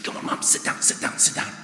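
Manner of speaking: urgent hushed whisper, pleading; embarrassed whisper. Retold or imitated. Teenager in church begging mum to sit, whispered. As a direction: hiss it under your breath so nobody hears.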